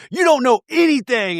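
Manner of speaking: high, loud mock yell in an argument; retold angry shout. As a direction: shout back in a heated fight. Retelling a heated reality-show fight.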